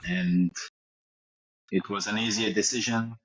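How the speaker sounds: footballer's put-on French accent, lightly done; fake French accent. Interviewed as a fake Giroud, mild French accent. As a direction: play a cocky French striker, accent on.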